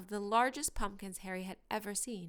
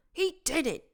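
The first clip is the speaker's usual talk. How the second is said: outraged growl, louder and higher; 7 semitones higher, 7 dB louder than usual. A narrator's reading. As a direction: snarl it in disbelief. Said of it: "he growled at Hermione".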